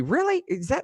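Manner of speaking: high incredulous really; shocked squeak at the headline; surprised. Real, not acted.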